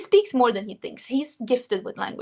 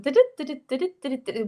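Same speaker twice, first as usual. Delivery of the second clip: "Did it, did it" in a bouncy, higher singsong; Swedish melody; 4 semitones higher, faster than usual. Shown as a demonstration. Streamer showing how Swedes talk, "did it" in singsong. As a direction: lilt each "did it" like Swedish speech melody. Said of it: "I also have the Swedish melody. And Swedes talk like".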